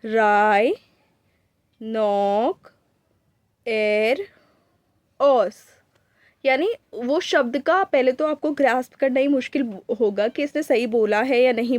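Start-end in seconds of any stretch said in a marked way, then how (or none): 0.1-5.5 rhinoceros split as राय नॉक एर ओस, each syllable apart with equal force; scanning speech demo. Shown as a demonstration. Doctor demonstrating a cerebellar patient's speech to students, syllable by syllable. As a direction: say राय नॉक एर ओस slowly, one syllable at a time with pauses. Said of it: "वो क्या करेगा".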